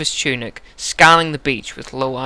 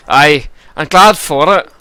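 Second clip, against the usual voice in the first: loud, slow Scottish brogue; gruff Glaswegian accent; 8 dB louder than usual. Acted. Giant glad to be back from travels, slow gruff Glaswegian accent. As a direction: drag it out in rough Glaswegian. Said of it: "we'll give him a Glaswegian accent".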